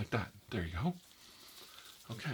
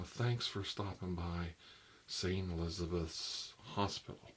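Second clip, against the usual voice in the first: slow reading aloud; 4 dB quieter, slower than usual. Acted. Reading decoration sign aloud.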